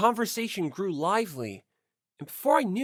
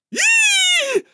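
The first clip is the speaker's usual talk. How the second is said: short shriek, far higher and loud; startled shriek; 11 dB louder than usual. Acted. Yamada startled in the dark room, short high shriek. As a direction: yelp like you were caught red-handed. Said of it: "A short shriek".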